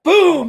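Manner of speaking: loud high mock drum boom; playful drum roll sound. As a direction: make a big drum hit with your voice. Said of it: "I'll give you a drum roll".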